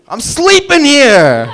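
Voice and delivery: put-on brash New York accent shout; New Yorker impression. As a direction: belt it like a New Yorker on the street. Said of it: "This is a New Yorker sleeping".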